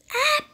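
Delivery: high startled scream; scream of fright. Real, not acted. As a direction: shriek as the banana grabs. Caught by the banana.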